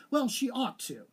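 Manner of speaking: high, quick snap; snapped. A narrator's reading. Madeline defends Anthony to her cousin, snapping high. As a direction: bite the line off sharply. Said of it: "snapped Madeline".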